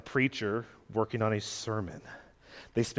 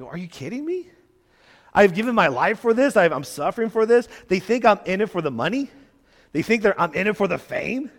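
raised, higher-pitched indignant protest; indignant disbelief; 5 semitones higher, 4 dB louder than usual. Acted. Paul hears he is being slandered, indignant disbelief. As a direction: push it out as an incredulous, wounded retort. Paul retorts to attacks on him.

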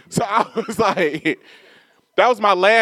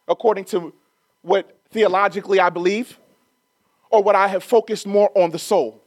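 ordinary delivery

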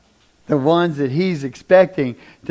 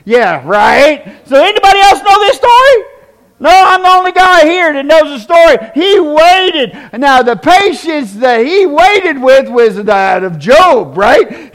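preaching at full force; 12 semitones higher, 13 dB louder than usual. Acted. Preacher tells the congregation a story, at full force.

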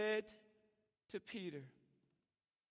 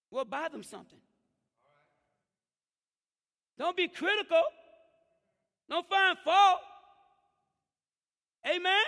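loud, high preaching voice, raised at the church; preaching at full force; 11 semitones higher, 17 dB louder than usual. Acted. Pastor preaching loud to the church about parents. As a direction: preach it out to the congregation.

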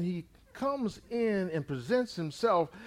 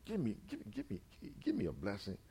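quiet pleading; 6 semitones lower, 14 dB quieter, faster than usual. Acted. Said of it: "Esau is pleading with his".

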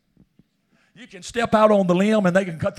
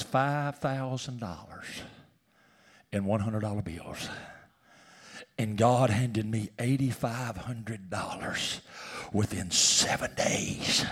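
slow, softer, reverent; hushed awe; 7 semitones lower, 9 dB quieter, slower than usual. Real, not acted. Preacher testifying to God's provision, slow and hushed. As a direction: let the wonder hush your voice.

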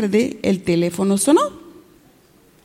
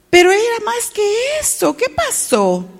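high-pitched dismay; acted shock; 10 semitones higher than usual. Storyteller finds the count short, high-pitched shock. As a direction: react aghast, pitched right up.

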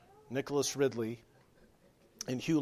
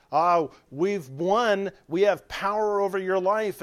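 gloating enemies; 5 semitones higher, 7 dB louder than usual. Retold or imitated. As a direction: crow over the victim. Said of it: "the enemies that said".

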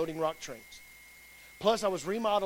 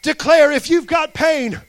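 loud, impassioned sermon delivery; shouted, emphatic exhortation; full-force preaching; 5 semitones higher, 16 dB louder than usual. Real, not acted. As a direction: preach it with mounting fire, driving every phrase home. Closing exhortation.

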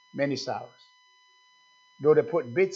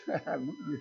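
high airy giggle in a put-on voice; breathy high mock laugh; 10 dB quieter than usual. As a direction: giggle like a thrilled pleasure-seeker. Mimics eager worldly churchgoers.

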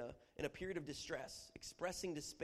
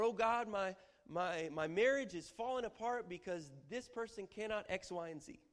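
higher, louder complaint to God; distressed plea; 5 semitones higher, 5 dB louder than usual. Acted. Preacher acting a troubled spouse praying. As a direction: lay out the trouble with urgency. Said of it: "portrayal of distress".

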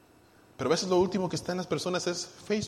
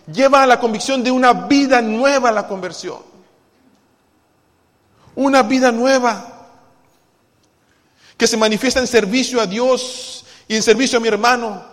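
loud, ringing preaching voice; fervent preaching; 7 semitones higher, 12 dB louder than usual. Acted. Pastor exhorting the church to humble itself, loud and fervent. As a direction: drive the point home to the congregation.

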